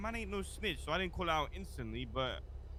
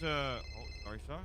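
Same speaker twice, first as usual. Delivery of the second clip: ordinary talk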